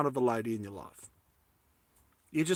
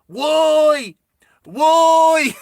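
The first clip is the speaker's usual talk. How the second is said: very high, loud drawn-out holler; piercingly high mock yell; mock calling out; 11 semitones higher, 12 dB louder than usual. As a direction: belt it out high, imitating a sudden call. Imitating her calling out asleep.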